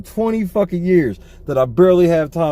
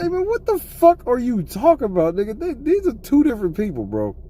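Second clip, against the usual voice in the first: exasperated; 6 semitones higher than usual. Retold or imitated. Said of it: "Eli said".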